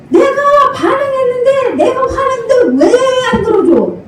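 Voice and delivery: put-on high, tearful child's complaint; whiny toddler. A toddler whining at mom for ignoring them, high and teary. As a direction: play a baby who wants it now.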